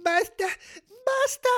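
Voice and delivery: high, slow, drawn-out cries; melodramatic anguish. Acted. Child hears dad will cook dinner, anguished protest. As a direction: beg for it to stop, overwrought. Child can't take more bad news.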